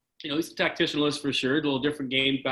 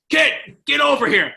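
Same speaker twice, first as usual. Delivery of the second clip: high, loud summoning shout; Mav's yell; 12 semitones higher, 7 dB louder than usual. Retold or imitated. Imitating coach yelling at him from the opposition bench, big high shout. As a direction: holler like a coach calling a player over. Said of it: "he yells at me".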